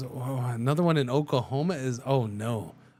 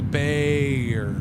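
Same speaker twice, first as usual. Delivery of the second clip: very slow "it would be bear", stretched out; super slow; 3 dB louder than usual. Shown as a demonstration. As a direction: drag "bear" out slowly to show the sounds. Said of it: "emphasize it super slow".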